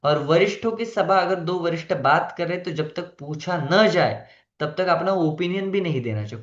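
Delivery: ordinary talk, nothing marked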